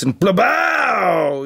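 slow, drawn-out blaster boom; blaster sound effect. Pretend. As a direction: shout a toy blaster blast. Toy blaster firing sound.

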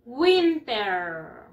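WINTER stretched out long; model pronunciation. Shown as a demonstration. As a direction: say "Winter" very slowly for pupils to copy. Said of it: "One more time". (pronunciación modelo, muy alargada)

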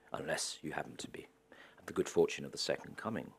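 conspiratorial unvoiced whisper; whispered aside. Real, not acted. Sarcastic aside.

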